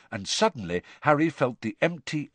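usual speaking voice

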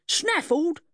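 high, indignant echo; high-pitched affronted man; outraged squeal. A narrator's reading. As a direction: throw the word back, squealing. Outraged man repeats the accusation.

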